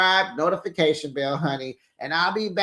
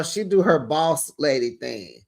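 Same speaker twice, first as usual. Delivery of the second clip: ordinary talk, nothing marked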